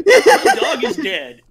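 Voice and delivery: loud, high, drawn-out mock wail; mock lament. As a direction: wail it slowly as a laughing parody. Parodying someone's complaints, laughing.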